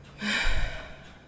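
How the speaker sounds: big open sigh after a breath; deep sighing exhale. Shown as a demonstration. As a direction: let the breath fall out in a sigh. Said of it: "You take a deep breath".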